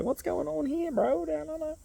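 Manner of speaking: high-pitched laid-back bro impression; imitating Jam's greeting. To the men's circle, doing Jam as a high-voiced bro. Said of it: "he's like".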